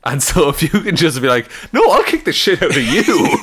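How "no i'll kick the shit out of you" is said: threat pitched up through laughter; laughing tough threat. Pretend. Cashier threatening the robber, laughing. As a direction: sound tough while laughing.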